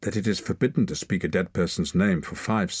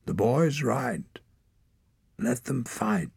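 higher, slow, probing; old mage; 7 semitones higher, slower than usual. A narrator's reading. Old mage pressing the clan leader, higher and slow. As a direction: weigh each word.

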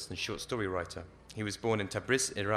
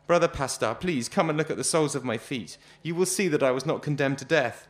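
raised, louder begging; desperate pleading; 5 semitones higher, 5 dB louder than usual. Acted. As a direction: plead urgently for a look. Pleading with guard.

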